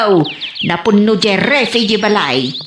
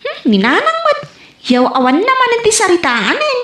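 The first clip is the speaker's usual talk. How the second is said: 1 lightly voiced mother; 8 semitones higher than usual. Mother voiced teasing her child.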